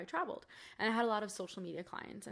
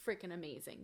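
quiet vow; 2 semitones lower, 8 dB quieter than usual. Retold or imitated. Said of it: "looking in the mirror and saying".

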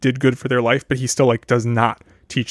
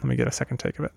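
ordinary talk

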